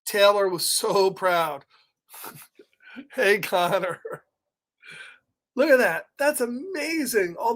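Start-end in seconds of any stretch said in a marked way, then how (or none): none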